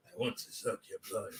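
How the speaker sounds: low, hushed vampire voice; Dracula. Acted. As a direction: murmur it like a lurking vampire. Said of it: "Pause, Dracula".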